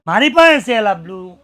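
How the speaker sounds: loud, gleeful boast; loud triumphant brag; gleeful shout. Retold or imitated. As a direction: crow it out in joy. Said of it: "આનંદ થઈને".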